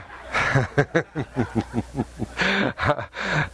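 long chuckle at own joke; drawn-out laugh with rising pitch. Real, not acted. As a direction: chuckle along after teasing. Joking about his wife.

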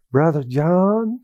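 slow, drawn-out Southern drawl. Retold or imitated. Churchman gently calling out a smoker, Southern drawl. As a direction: imitate a low-key, kindly Southern drawl. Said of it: "low key with a Southern drawl".

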